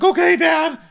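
put-on loud high little-boy voice; mocking Eddie as a little boy, high and loud; childish high voice. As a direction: answer like a little kid.

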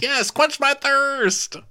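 very high, slow, gushing cry; mock delight. Host begs for the threatened drink, mock delighted. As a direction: squeal it slowly as if thrilled.

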